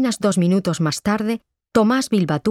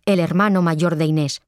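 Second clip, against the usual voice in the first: ordinary delivery, nothing marked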